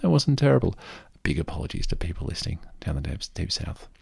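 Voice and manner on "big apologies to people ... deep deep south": whispered apology, fast; a quick guilty aside under the breath; sheepish whisper. Real, not acted. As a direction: whisper an apology to Southern listeners. Apologising aside to Southern listeners.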